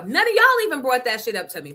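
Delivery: ordinary delivery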